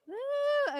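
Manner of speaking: high, loud whoop; excited woo. Real, not acted. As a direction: let out a big high cheering "woo". Cheering the audience's readiness for poetry.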